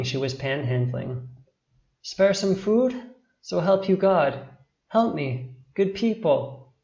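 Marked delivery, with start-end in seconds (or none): 2.0-6.7 put-on higher, pleading child's voice; feeble begging. A narrator's reading. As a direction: beg weakly for help, lightly acted. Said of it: "she begged in a feeble voice".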